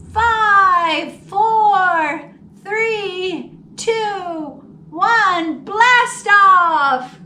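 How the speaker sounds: loud, high, rising countdown to blast off; excited countdown. Acted. Narrator counting down George's rocket launch, loud and high.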